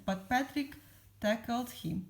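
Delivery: plain reading voice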